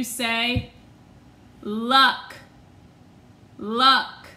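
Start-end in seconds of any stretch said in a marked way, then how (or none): none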